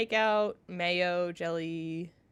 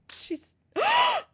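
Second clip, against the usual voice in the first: startled gasp; 6 dB louder than usual. Acted. Cat bites her character.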